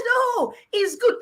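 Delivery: high, drawn-out imitation of mum; slow, high-pitched mock reassurance; mother's coaxing. Mother urging her child to drink the smoothie, imitated coaxing. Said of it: "She said".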